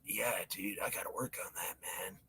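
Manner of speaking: breathy, unvoiced whisper. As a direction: whisper it back like a secret. Whispered reply between dudes.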